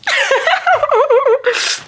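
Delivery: high-pitched rapid giggle; squeaky happy laugh; giddy laugh. Real, not acted. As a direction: burst of high, excited giggling. Giddy laugh after greeting.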